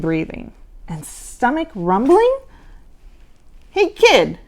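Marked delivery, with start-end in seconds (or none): 3.7-4.4 growled. Acted. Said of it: "Gabe growled".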